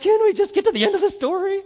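high, loud, fast mock exasperation; impatient husband. Pastor mimics husband begging wife to finish, exasperated. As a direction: whine it like a husband who can't stand the long story.

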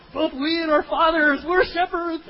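proudly overshare the word; overexcited blurting. Retold or imitated. Brothers proudly repeating they are shepherds, high loud and slow.

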